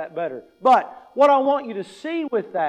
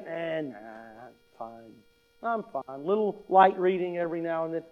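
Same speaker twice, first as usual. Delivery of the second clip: much softer, lower, offhand brush-off; dismissive shrug; 4 semitones lower, 6 dB quieter than usual. Pretend. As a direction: wave the idea away lazily.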